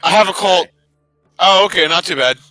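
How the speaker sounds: ordinary talk, nothing marked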